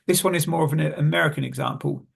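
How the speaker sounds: ordinary talk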